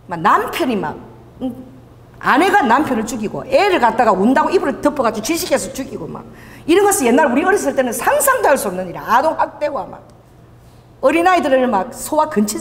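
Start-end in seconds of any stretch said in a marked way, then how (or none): none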